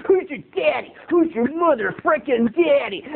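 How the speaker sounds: high, hammed-up kid bully; bully's taunt. Evil freckled kid threatens the boys in the rec hall, jeering. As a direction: jeer it like a nasty kid.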